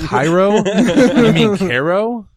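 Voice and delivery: an amused "you mean Cairo?"; laughing disbelief. Retold or imitated. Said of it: "And I was like".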